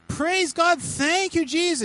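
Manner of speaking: loud, high cry of thanks; acted ecstatic celebration; jubilant praise. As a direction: whoop it out like someone just healed. Second healed man rejoices.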